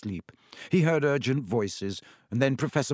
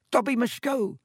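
high, breathy frightened elf; terrified breath; 12 semitones higher than usual. A narrator's reading. Dobby fleeing the footsteps, terrified. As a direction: gasp it out in fright. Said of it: "breathed the elf, terrified".

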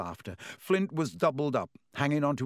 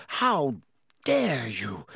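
shrieked; much slower than usual. A narrator's reading. Alicia shrieking at Malfoy over the insult. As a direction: cry out in shock at the insult. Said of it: "Alicia shrieked".

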